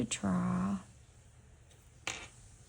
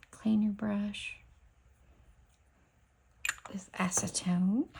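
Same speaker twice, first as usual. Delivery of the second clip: slow whispered reminder. Real, not acted. Reminding viewers to clean the brush with acetone, slow whisper. Giving a cleaning instruction while working.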